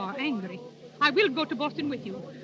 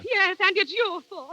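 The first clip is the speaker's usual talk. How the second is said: angry blame; 3 semitones higher, 5 dB louder, slower than usual. Acted. Singer blaming her manager.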